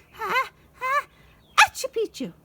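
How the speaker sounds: sneezing out the words; half sneeze, half speech; sneezy password. A narrator's reading. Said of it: "half sneezed, half spoke".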